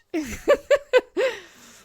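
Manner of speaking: loud, high burst of laughter. Real, not acted. Reacting to huge spiders in the game, high loud laugh.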